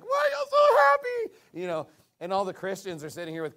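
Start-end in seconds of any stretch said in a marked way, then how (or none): 0.0-1.6 much higher and louder mock whine; mocking whiny sinner. Preacher mocking sad unbelievers to the congregation, high shrieking whine. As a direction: squeal it like a miserable, jealous sinner.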